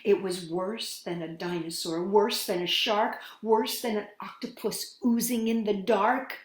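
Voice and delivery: plain reading voice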